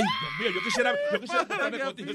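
high, strained mock outburst; mock rage. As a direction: squeal it out as a hammed-up jealous man. Prank caller acting enraged.

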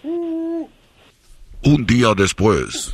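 very slow, low dramatic caption; deep narrator voice. Acted. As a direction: stretch out the time skip. Narrator time-skip caption.

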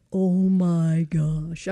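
low, slow, drawn-out amazement; retold awe. Recalling hearing it was Dave, slow awestruck reaction. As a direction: stretch each word out in hushed awe.

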